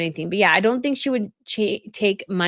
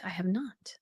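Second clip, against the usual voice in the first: whisper; 11 dB quieter than usual. Real, not acted.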